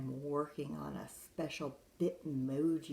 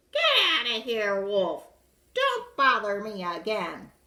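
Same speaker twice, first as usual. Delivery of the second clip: louder mock-rude brush-off; rude pig snapping; 7 semitones higher, 14 dB louder than usual. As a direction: shoo the wolf away like a rude pig. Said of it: "that rude little porker answered".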